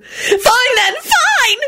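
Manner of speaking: high, screeched, breaking into crying; screeching into tears. A narrator's reading. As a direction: shriek it as the tears come. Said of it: "Rosie screeched and burst into tears".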